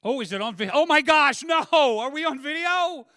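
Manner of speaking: high, very loud hammed-up alarm; comic panicked shout; mock panic. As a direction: yell in pretend horror, stretching the last words. Pretends alarm at being recorded.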